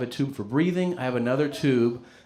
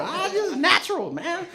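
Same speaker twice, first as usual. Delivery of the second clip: put-on high, smug drunken reply; mock-cool drunk boast; cocky drunk brag. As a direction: toss it off like a cool guy. Drunk him bragging.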